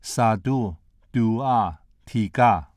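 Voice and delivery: "satu, dua, tiga", each word starting on the third tone; Malay third tone. Shown as a demonstration. Lecturer demonstrating Malay counting, "satu, dua, tiga" in Malay tones. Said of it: "each word starts with the third tone".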